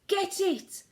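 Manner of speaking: high, loud hunting shout. Acted. Cooks chasing the mouse, shouting loud. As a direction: yell it to the others. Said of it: "they were shouting".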